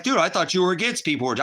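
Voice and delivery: high, fast mock voice of the critics. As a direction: rattle it off like the people who banned you. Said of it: "They're like".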